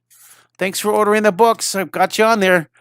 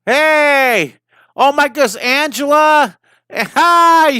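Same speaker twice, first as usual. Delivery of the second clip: much higher and louder, a delighted hello; excited greeting; 9 semitones higher, 5 dB louder, much slower than usual. Real, not acted. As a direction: greet her like a friend who just walked in. Greets a viewer who joins live.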